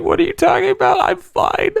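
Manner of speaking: very high, squeezed choked voice; choking denial. Retold or imitated. Pepper-sprayed fighter denying he's choking, strained. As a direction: insist through a tight, strangled throat. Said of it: "You're choking too".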